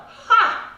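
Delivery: loud, high single bark of mock laughter; scoffing 'ha'. Uncle jeering at grandma's offer, scoffing. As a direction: scoff in one sharp burst. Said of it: "my uncle said".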